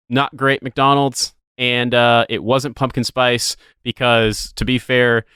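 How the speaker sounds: usual speaking voice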